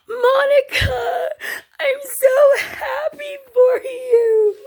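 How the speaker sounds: very high, loud, stretched-out wail; hammed-up weepy shriek, drawn out slowly; imitated tearful squeal. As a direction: squeal congratulations as if crying for a friend. Coworker squealing congratulations to winner.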